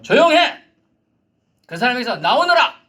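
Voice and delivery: high, loud shouted order; commanding shout. Acted. Jesus ordering the demon out in a shout. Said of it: "명령을 하는 거예요".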